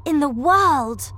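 high, loud, wholehearted enthusiasm; eager child's high exclamation; excited cry. A narrator's reading. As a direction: gush about a favourite place. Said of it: "cried Charlie".

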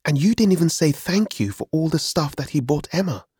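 put-on high, reproachful young voice; cross brother. A narrator's reading. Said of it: "Adam turned to me angrily".

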